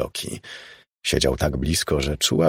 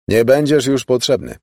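louder, higher call to someone leaving; calling after; 6 semitones higher, 5 dB louder than usual. A narrator's reading. Chester dismisses the departing butler, calling out. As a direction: call it across the room. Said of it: "zawołał za nim Chester".

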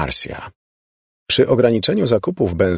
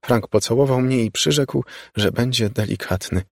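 plain reading voice

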